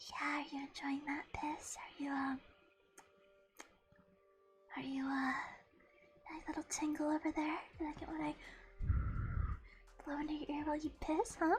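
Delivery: soft breathy ASMR whisper. Retold or imitated. Streamer retelling her mock ASMR to chat, whispered. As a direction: whisper it close like an ASMR mic. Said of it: "And I started whispering".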